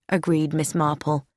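ordinary delivery, nothing marked

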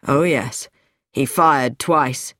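low, measured male character voice; dismissive man. Acted. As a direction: confirm it in deep, unhurried tones. Lewis answers in his character voice.

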